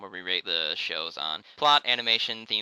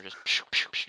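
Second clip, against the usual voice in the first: laser zap noises. Retold or imitated. Imitates wrist laser shots.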